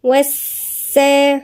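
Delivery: والسا with a doubled sin drawn out; tajweed fragment; slow demo of والسا. Shown as a demonstration. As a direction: isolate والسا slowly. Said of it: "هنا في إيه؟".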